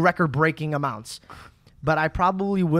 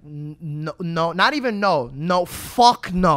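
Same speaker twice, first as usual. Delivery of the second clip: emphatic refusal; 4 dB louder, slower than usual. Retold or imitated. Host reacting to a guest's claim, building loud refusal. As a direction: start under your breath and build to a loud, slow refusal. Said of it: "I was like".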